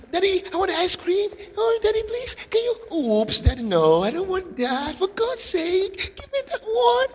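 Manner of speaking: put-on high, childish begging voice; mock little child nagging for ice cream; whiny rich kid. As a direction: play a spoilt child pestering daddy. Mimics rich kids begging father.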